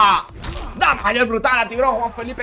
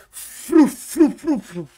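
loud, higher mock whooshing noise; imitated whoosh; 7 semitones higher, 7 dB louder, much slower than usual. Streamer imitating how the opponent attacks him, mock whoosh. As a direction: mimic rapid attacks with your voice.

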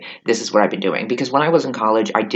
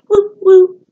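very high, loud playful woo; high alien whoop; 10 dB louder than usual. Pretend. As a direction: squeal it out like a cartoon alien. Alien greeting continues.

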